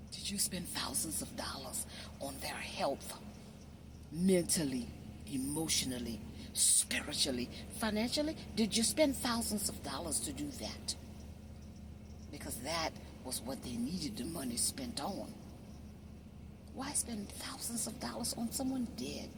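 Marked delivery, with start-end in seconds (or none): 0.1-3.1 whispered, soft pointed question. Real, not acted. Preacher whispering a pointed question to mourners. Confronting listeners about spending. 16.8-19.3 whispered, soft pointed question. Real, not acted. Preacher whispering a pointed question to mourners. Confronting listeners about funeral costs.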